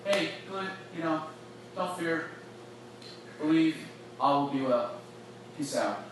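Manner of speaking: breezy and offhand, lightly dismissive; casual brush-off. Pretend. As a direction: toss it off like a passing goodbye. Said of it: "It's not like".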